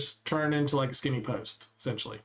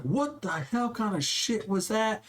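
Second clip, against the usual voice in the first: higher, slow angry coach yell retold; coach going off; 6 semitones higher than usual. Coach tearing into a freshman player, angry retold yell. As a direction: bark it like an outraged coach. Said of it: "just starts going off on me".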